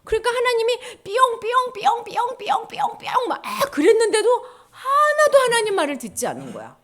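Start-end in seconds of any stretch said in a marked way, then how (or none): 1.0-3.3 high, insistent siren noise; imitated warning siren. God's warning to people who won't listen, high siren. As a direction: repeat it urgently like an alarm going off. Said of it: "하나님이 경고등으로".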